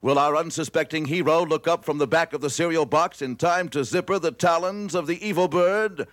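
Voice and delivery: ordinary talk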